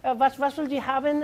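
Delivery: high-pitched, polite German server. Acted. German server greeting a customer, politely. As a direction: pitch it up as a hesitant waiter. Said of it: "someone would be there and be like".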